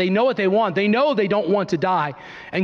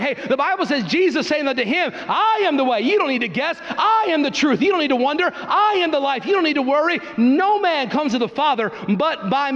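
high, ringing call and response; rising revival preaching; fiery preaching; 7 semitones higher than usual. Acted. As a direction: preach it out, answering each claim. Preaching at full force.

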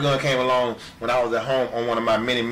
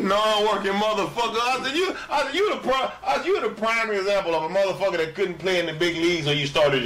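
higher, laughing mock tirade; retold insult; 9 semitones higher than usual. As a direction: tear into him half laughing.

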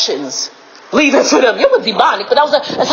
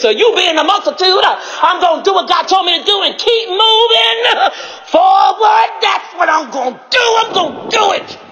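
fervent preaching; 5 semitones higher than usual. Real, not acted. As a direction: preach it with rising fervour. Preacher builds to a defiant declaration.

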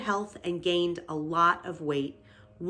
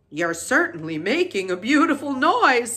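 put-on loud, higher, irritated old sheep; snapped; 5 semitones higher, 6 dB louder than usual. A narrator's reading. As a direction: snap it with heavy sarcasm. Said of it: "snapped the old sheep".

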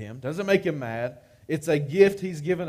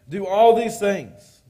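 loud, slow, pleading; urgent plea; 5 semitones higher, 7 dB louder, slower than usual. Acted. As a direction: beg God to keep his word. Moses pleading with God.

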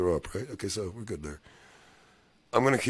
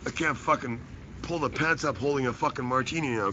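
ordinary talk, nothing marked

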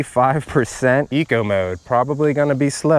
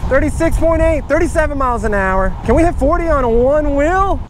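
excited yelling; 12 semitones higher than usual. Real, not acted. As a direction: shout the speed with a thrill. Speed test, one wheel drive.

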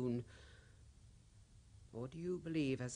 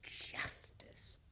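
breathy whispered scoff; bitter whisper; 7 dB quieter than usual. Acted. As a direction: hiss the word back at him. Bitter echo of his word.

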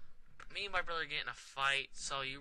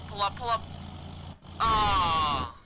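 panicked yell; 7 semitones higher, 5 dB louder, slower than usual. Real, not acted. Plane about to crash.

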